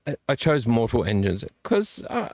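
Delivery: ordinary talk, nothing marked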